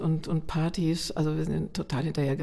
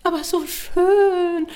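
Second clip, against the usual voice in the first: high, loud, long-drawn mock swooning; gushing relatives; 12 semitones higher, 6 dB louder, much slower than usual. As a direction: insist on it like adoring relatives, stretched out. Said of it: "alle haben geschwärmt".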